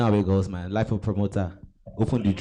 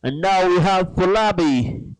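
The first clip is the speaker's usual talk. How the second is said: announcer voice; 5 semitones higher, much slower than usual. Acted. Host opens the live show.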